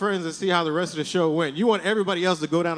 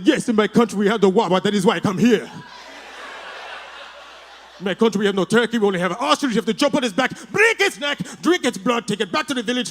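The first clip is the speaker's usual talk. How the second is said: put-on African warlord accent; 3 semitones higher than usual. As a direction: boom it out in a mock African accent, menacing. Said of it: "pretend I'm this crazy-ass African warlord".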